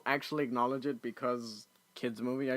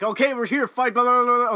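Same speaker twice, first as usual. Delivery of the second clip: higher, louder mock action recap; mock hurried excitement; 8 semitones higher, 9 dB louder than usual. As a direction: rattle off the fight in a loud, mocking burst. Mocks rushed climax as acted quote.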